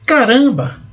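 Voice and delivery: high, lively "Caramba!"; exclaimed caramba. Shown as a demonstration. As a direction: blurt it out in surprise. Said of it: "Declaração de alegria ou decepção". (exclamação de surpresa)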